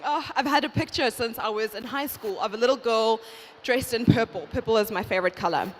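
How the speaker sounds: ordinary delivery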